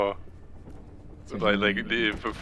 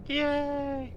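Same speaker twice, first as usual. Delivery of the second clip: mock cheer; 6 dB quieter than usual. Mock cheer about mod streams.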